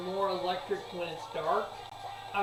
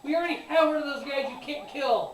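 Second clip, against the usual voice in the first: higher, louder, laughing exasperation; exasperated rant; 8 semitones higher, 6 dB louder than usual. Retold or imitated. Fan ranting at the booking of an unkillable hero, loud and high. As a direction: vent like a fed-up fan. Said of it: "It's like".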